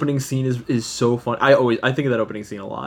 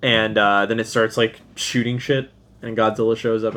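normal talking voice

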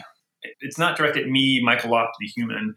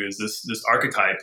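usual speaking voice

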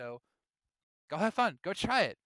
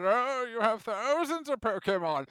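put-on slow, higher crotchety old man; 5 semitones higher, 6 dB louder, slower than usual. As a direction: grumble like a proud old-timer. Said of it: "pull out the old man card".

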